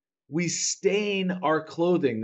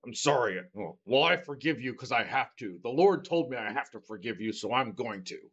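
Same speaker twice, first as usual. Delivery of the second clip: grudging angry apology; 4 semitones lower, 4 dB quieter than usual. Retold or imitated. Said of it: "You can't just be the angry".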